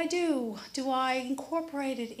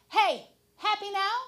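taunting; 8 semitones higher, 6 dB louder than usual. Retold or imitated. Taunting the crow.

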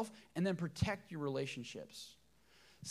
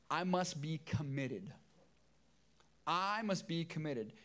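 slow dictation; slower than usual. Shown as a demonstration. Repeated slow for notes.

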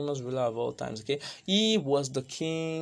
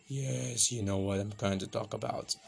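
low, soft stranger's voice; slightly wrong copied voice; 6 semitones lower, 7 dB quieter than usual. Shown as a demonstration. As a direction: disguise your voice as someone unknown. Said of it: "I'm gonna talk like somebody you don't know".

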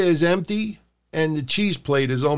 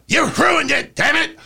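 angry Vince shout; 11 semitones higher than usual. Retold or imitated. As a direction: scream it like a furious boss.

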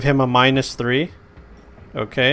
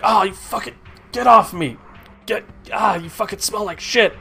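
choking guard; 7 semitones higher than usual. Acted. Choked guard struggling, cursing.

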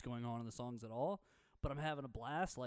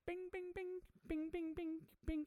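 imitating mandolin; 4 dB quieter, slower than usual.

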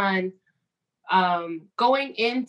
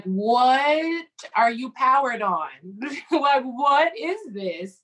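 incredulous, stretched-out and a little higher; mock disbelief; 3 semitones higher, much slower than usual. People hearing her story react, mock disbelief. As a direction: draw it out, baffled. Imitating others' astonished reaction.

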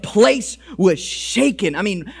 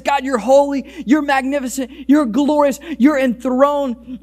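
crying praise; 7 semitones higher than usual. Retold or imitated. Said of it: "He just starts crying".

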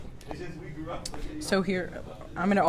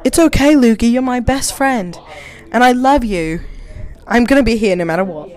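louder, tender reading of Michael; tender friend voice; 4 semitones higher, 10 dB louder than usual. Pretend. Friend reading aloud as Michael, comforting Luke, hammed-up. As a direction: reassure your best friend, a bit hammed-up. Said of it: "Michael wrapped his arm".

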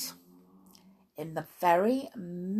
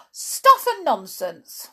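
high, slow, indignant outburst; loudly; 6 semitones higher, 8 dB louder, slower than usual. A narrator's reading. Alice objecting to the queen's order, loud indignant scoff. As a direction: scoff loudly, stretching the words. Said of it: "said Alice loudly".

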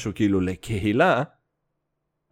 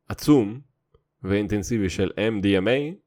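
usual speaking voice

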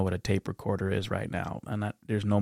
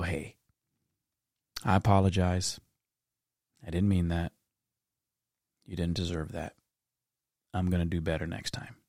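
soft, much quieter, sincere apology; gentle apology; 5 semitones lower, 8 dB quieter than usual. Retold or imitated. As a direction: make it up to the hurt kid softly. Aunt apologizes gently later.

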